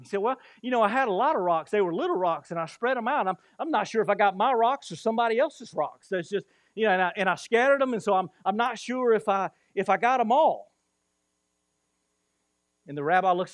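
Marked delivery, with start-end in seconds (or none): 0.2-10.7 high, loud, rambling excuses; imitated defensive babble, pitched up; flustered excuse-maker. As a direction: act out someone stumbling through an excuse. Voices the man making flustered excuses.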